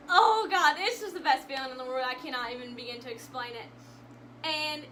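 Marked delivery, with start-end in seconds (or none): 0.1-2.0 overjoyed gushing. Real, not acted. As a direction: gush it out, overwhelmed by the memory. Gushes over the best feeling.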